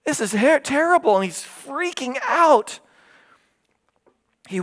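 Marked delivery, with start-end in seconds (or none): 0.1-2.8 rising alarm. Real, not acted. Preacher dramatizing prophet's horror.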